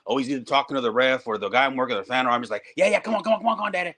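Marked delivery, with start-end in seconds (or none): 2.8-3.9 high, eager coaxing chant of come on; urging himself on. Retold or imitated. Wrestler coaching himself through a hold, eager chant. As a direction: pump yourself up mid-match.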